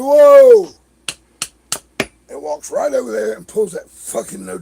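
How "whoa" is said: high, loud whoa of surprise; startled whoa. Retold or imitated. Bubba startled by Max showering in underwear, high loud whoa. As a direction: jump back in surprise. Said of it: "He said".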